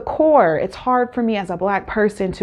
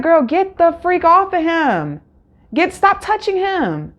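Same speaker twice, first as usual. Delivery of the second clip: exasperated scolding; 8 semitones higher than usual. Retold or imitated. Scolding contestant touching Matt.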